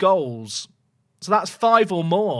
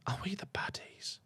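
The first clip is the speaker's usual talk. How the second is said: hushed guilty whisper; mock whisper; 20 dB quieter than usual. Streamer joking to chat, whispered. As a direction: lean in and whisper it.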